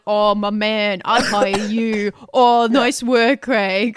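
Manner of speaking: slow, drawn-out gushing praise; imitating fan replies. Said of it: "all these replies have been like".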